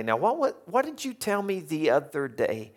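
high mocking question; gloating tease. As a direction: needle someone playfully. Friends gloating over your mistake.

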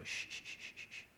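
very soft, steady shushes; soothing shush. Acted. God trying to settle a screaming baby, very soft shushes. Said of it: "God's just trying to go".

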